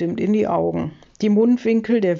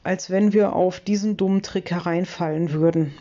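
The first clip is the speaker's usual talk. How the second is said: ordinary delivery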